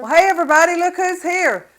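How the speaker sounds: high, loud, excited hello to viewers; real excitement, pitch jumping way up; excited greeting. Real, not acted. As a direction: call out brightly to show off a guest. Introducing mother-in-law to the camera.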